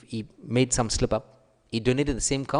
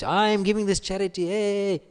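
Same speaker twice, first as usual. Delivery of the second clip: much higher, showing off the gift; boastful announcement; 10 semitones higher than usual. Shown as a demonstration. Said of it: "No, not like that".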